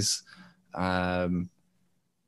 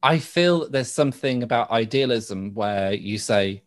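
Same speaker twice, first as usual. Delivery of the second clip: ordinary delivery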